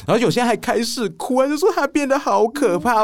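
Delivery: high excited retelling. Real, not acted. Retelling kids crying.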